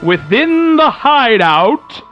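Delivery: usual speaking voice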